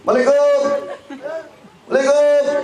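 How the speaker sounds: short, very high-pitched call; imitating a delivery courier calling at the gate; courier's salam. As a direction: pipe the greeting out high and quick. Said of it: "Tapi kalau salamnya begini".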